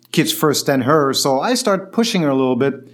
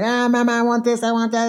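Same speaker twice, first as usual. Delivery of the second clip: put-on whining kids; 7 semitones higher than usual. Kids nagging their mother at the park, whiny. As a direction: beg like little kids pestering mom. Kids begging in retold story.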